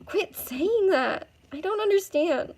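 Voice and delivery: high, drawn-out upset plea; frustrated protest. Acted. Upset girl protests to rescuer.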